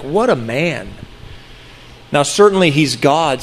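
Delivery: ordinary talk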